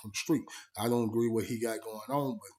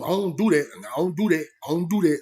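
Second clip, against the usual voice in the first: emphatic insisting; 4 semitones higher, 6 dB louder than usual. Retold or imitated. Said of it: "That's what he was saying".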